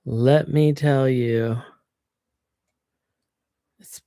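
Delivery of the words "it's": sighing whisper. Real, not acted.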